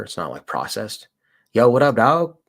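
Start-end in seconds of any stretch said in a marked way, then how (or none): 1.5-2.4 loud, cheery shout-out to a viewer; energetic livestream greeting. Real, not acted. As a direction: call out a hype hello to the chat.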